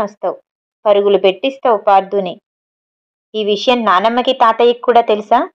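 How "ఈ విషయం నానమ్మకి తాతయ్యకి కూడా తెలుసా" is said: ordinary delivery, nothing marked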